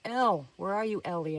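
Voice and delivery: urgent narrated cry that drops off; narrated scream. A narrator's reading. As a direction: call out for a missing friend, understated scream. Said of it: "I screamed".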